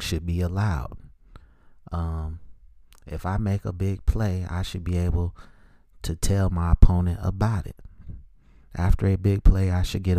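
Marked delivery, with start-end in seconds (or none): none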